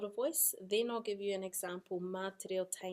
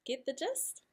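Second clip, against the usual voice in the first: normal talking voice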